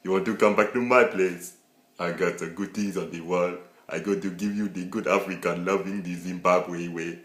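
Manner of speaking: loud put-on African accent; mock African accent, slowing at the end; hammed-up African accent. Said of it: "Don't do an Indian voice or an African".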